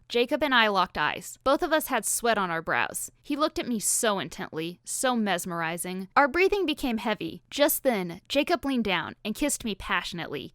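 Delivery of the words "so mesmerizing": ordinary delivery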